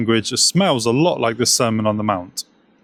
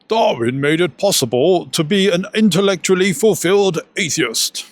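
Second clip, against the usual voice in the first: higher-pitched, put-on English intellectual accent; hammed-up English accent, pitched up; Richard Dawkins accent; 6 semitones higher than usual. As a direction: do your best impression of an English academic accent. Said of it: "in my best Richard Dawkins accent".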